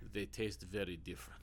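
low, hushed film-line imitation; imitated dramatic delivery. Co-host imitating the film's funny line delivery, low and hushed. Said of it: "like, a fifteen second pause".

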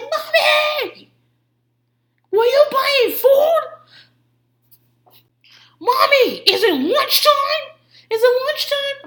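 very high, slow mock yell; toddler on a ten. Three-year-old greeting mommy walking in, hammed-up toddler yelling. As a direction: holler full blast as an overexcited little kid. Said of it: "everything he says is on a ten".

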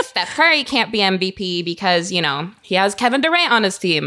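ordinary talk

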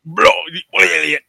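put-on drawn-out, slurred British accent; mock British accent. Podcaster trading British phrases with co-host, mock British accent. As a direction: stretch it into exaggerated British. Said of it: "you got a permit to be that British".

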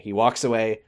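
ordinary delivery

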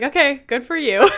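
amused dismissal. Acted. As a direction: brush them off with a laughing mock cheer. Voicing Eleanor's amused dismissal.

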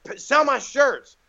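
high, loud, drawn-out imitation of a bossy star; impatient order, imitated with force; mock irate demand. Star insists the doorman sell his shirts, demanding.

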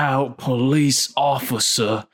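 slow, hammed-up attempt at a brooding film-trailer hero; mock movie-star read, dragging every word; a half-laughing, slow stab at a British action star; Daniel Craig. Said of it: "I was trying to do my, uh, Daniel Craig".

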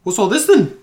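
high, loud mock-Scottish; loud dwarf challenge in Scottish accent. Shown as a demonstration. Game master retrying the dwarf voice, Scottish accent. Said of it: "do your best Austin-style Scottish accent".

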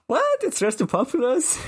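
high gasp of disbelief; shocked. Acted. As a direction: squeak it out in shock. Shocked at villain's return.

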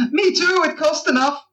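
very high, comic parting shot; high-pitched retort. Acted. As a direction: toss it back in a squeaky pitch. Joking parting retort to bartender.

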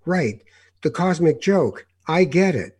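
high, loud, slowed "oh right, I get it"; young seeker mocking his own acid epiphany; mock dawning realization. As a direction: play the tripped-out kid who suddenly gets it. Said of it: "and go".

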